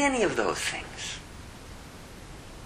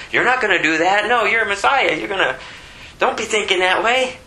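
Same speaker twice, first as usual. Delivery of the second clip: higher, urgent mock rebuke; Peter rebuking; 7 semitones higher than usual. Peter rebukes Jesus, urgent and insistent. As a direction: shake him and insist. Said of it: "Peter rebukes Jesus".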